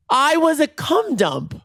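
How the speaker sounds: high, drawn-out theatrical lament; melodramatic wail. Pretend. Dumped storyteller lamenting to listeners, high melodramatic wail.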